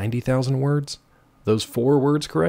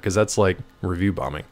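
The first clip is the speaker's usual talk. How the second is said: normal talking voice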